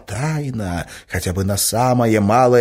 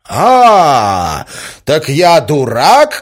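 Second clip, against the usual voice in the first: loud, high outburst of fury; furious; 8 semitones higher, 11 dB louder, much slower than usual. A narrator's reading. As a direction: boil over with rage, drawing the words out. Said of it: "закипел от ярости". (в ярости, криком)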